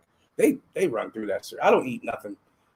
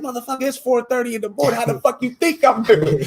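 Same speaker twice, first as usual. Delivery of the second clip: higher, louder grumpy snapping; 10 semitones higher, 4 dB louder than usual. Retold or imitated. Rapper woken at dawn snapping at a greeter, annoyed. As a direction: bite back at a too-early greeting. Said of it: "And he's like".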